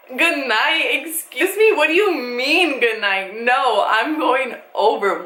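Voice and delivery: acted-out outraged comeback, drawn out; can't believe what you just heard; indignant disbelief. Acted indignant reply to dad's goodnight.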